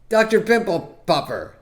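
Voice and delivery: slow, high, drawn-out announcement; milk the punchline name slowly; mock announcing. Comic announcing the silliest nickname.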